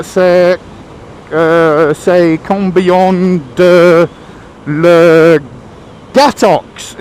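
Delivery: put-on loud, slow English-accented French; halting schoolboy French. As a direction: labour through bad French word by word. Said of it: "trying to remember his French from school".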